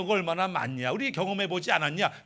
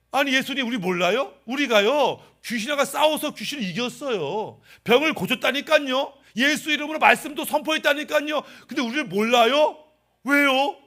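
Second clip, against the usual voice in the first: acted disbelief, higher and insistent; indignant protest; 5 semitones higher, 4 dB louder than usual. Rejected believers argue with Jesus about their works, indignant. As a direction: protest in rising volume and pitch.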